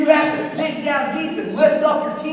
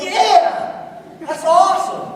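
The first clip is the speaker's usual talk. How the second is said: loud, high imitated yeah; acted burst of excitement; fired-up agreement; 6 semitones higher, 5 dB louder, slower than usual. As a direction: act out catching the enthusiasm. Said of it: "is like".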